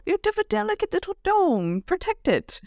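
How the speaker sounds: high, fussing mother voice; mom voice. Acted. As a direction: cluck over them like a mom. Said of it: "That's my mom voice".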